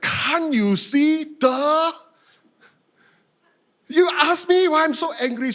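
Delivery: high, loud indignant comeback; mock-outraged whine with drawn-out words; upset retort. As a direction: throw it back, exasperated and incredulous. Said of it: "I was so upset".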